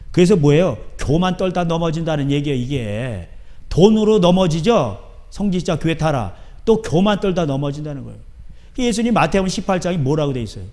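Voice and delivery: ordinary talk, nothing marked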